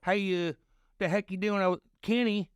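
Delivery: high, drawn-out Missouri drawl. Retold or imitated. Buddy mimics a Missouri local greeting Kenny, in a Missouri drawl. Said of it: "That is a drawl".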